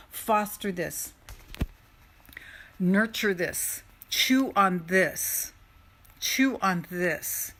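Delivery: slow, spaced-out commands; drawn-out coaching; slow emphatic. Shown as a demonstration. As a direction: let each word sink in slowly. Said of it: "slow commands to the audience".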